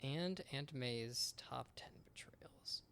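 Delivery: slow whisper; whispered disbelief. Real, not acted. Friend takes in the pun's punchline, whispered disbelief. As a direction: whisper the awful pun to yourself.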